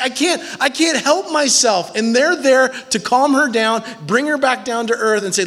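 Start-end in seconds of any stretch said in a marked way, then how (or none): 0.0-2.0 high, breathless lovesick cry; a hammed-up swoon, pitched high; mock enthralled. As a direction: play the swooning bride. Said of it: "so enthralled with this guy".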